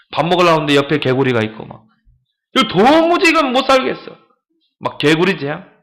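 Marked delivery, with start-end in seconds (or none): 2.6-4.2 higher exasperated outburst; exasperated cry. Retold or imitated. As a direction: throw up your hands, can't take it. Egyptians fed up with frogs.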